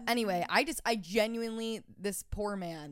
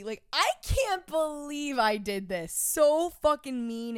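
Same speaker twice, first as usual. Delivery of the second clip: mock horror; 6 semitones higher, 4 dB louder, slower than usual. Real, not acted. Guilty over the hickey.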